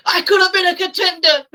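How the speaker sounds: a hammed-up Marlon Brando lament; Brando impression. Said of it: "Brandoing in the middle".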